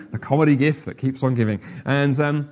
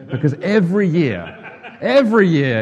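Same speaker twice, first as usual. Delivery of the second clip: laughing; 6 semitones higher, 9 dB louder than usual. Real, not acted. Laughing mock complaint about taxes.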